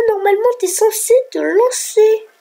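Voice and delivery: high, loud complaint, dragging the last word; high-pitched frustrated protest; exasperated. Real, not acted. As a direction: whine at a program that won't launch. Fed up program will not launch.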